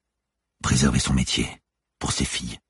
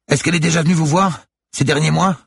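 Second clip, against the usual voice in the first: high, loud, urgent shout; raised, high-pitched yell; yelled question; 6 dB louder than usual. A narrator's reading. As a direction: shout the question across the waves. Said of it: "hurla-t-elle soudain".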